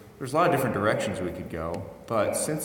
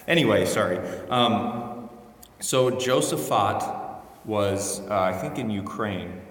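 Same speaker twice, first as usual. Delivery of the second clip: ordinary delivery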